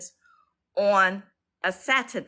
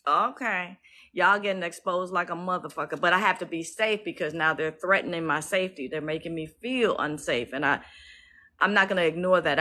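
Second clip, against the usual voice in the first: normal talking voice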